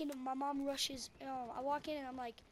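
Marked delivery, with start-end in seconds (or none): none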